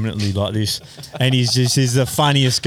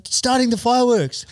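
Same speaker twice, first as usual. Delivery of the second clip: proud answer; 13 semitones higher than usual. Retold or imitated. Retold rider answering proudly.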